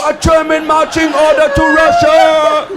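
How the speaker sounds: high, loud, drawn-out cry of alarm; hammed-up panicked announcement; mock alarm. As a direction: shout it slowly like a warning to a crowd. Acts panicked crowd hearing German.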